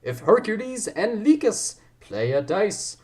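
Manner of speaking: high, swooping put-on accent; hammed-up theatrical accent; the Ryan accent. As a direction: declaim in an exaggerated accent, stretching it out. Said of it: "gonna go for the Ryan accent".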